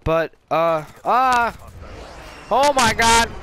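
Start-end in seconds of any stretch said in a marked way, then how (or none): 1.0-3.3 loud, high shout of shock; real startled yell. Real, not acted. Gamer shocked mid-story by the game, startled yell.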